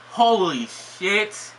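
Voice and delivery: slow, drawn-out disbelief; stunned. Real, not acted. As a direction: let the shock sink in. Realizes same day coincidence.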